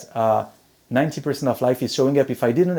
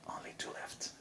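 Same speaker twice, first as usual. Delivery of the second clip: whispered aside; 25 dB quieter than usual. Real, not acted. Speaker aside about time left.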